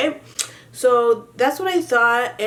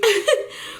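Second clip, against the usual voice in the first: short laugh; 11 semitones higher than usual. Real, not acted. Laughs telling the story.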